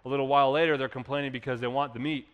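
usual speaking voice

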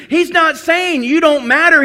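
normal talking voice

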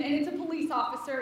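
usual speaking voice

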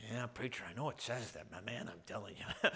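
low, subdued objection to the preacher; a hint of a reluctant objector, quiet and low; lightly voiced doubting churchgoer.

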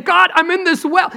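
high, urgent prayer from inside the whale; preacher acting out a desperate prayer; Jonah crying out. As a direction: call up to God from the depths. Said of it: "He's saying".

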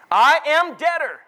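slow, loud, high declaration; ringing Bible reading; scripture proclamation. Real, not acted. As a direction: proclaim it deliberately from the pulpit.

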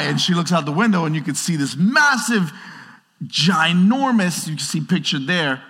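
usual speaking voice

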